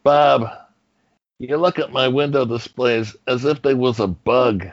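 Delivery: mumbling, heavy godfather-style Brando; a Brando voice scolding Bob about window displays; Marlon Brando impression. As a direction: do Brando playing the boss Ernie. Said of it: "my Ernie impression was basically just me doing Marlon Brando".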